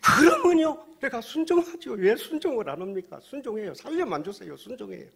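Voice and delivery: high, loud burst of desperate promises; frantic pleading. Acted. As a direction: beg at the top of your voice, then tail off. Man frantically promises God obedience.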